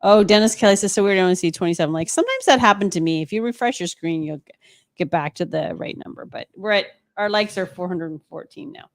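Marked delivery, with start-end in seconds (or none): none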